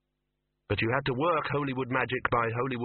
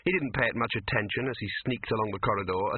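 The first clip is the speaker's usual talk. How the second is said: plain reading voice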